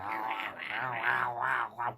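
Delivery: imitating the dog's troll-like yowling; repeated yowls in a small troll's voice; little troll voice. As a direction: mimic a grumbling little troll dog. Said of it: "she has a little troll voice".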